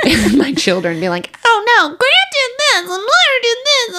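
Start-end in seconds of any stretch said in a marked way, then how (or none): 1.4-4.0 put-on whiny kid voice. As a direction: whine like a kid telling on siblings. Mom voicing kids tattling on siblings.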